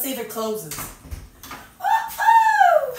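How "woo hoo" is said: high, loud celebratory whoop; excited whoop. Real, not acted. Organizer cheering as the shelf closes, whooping. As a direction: cheer like you won.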